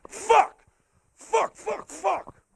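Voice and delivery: very high, squeaky mock cursing; frustrated little character. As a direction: curse in a tiny high-pitched voice.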